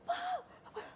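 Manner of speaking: high shrieked call of mom; high-pitched mock shriek; retold scream. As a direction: scream it out in shock. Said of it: "I'm screaming".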